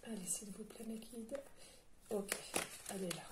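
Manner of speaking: soft whispered appeal to her guides; hushed unvoiced prayer; whispered plea. Real, not acted. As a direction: breathe the request under the breath.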